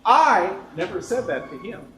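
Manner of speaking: the word I punched loud and high; stress on I. Shown as a demonstration. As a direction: hit the word I hard, the rest plain. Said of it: "emphasize the first word".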